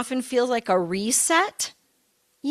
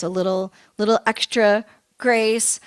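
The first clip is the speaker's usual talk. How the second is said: ordinary talk, nothing marked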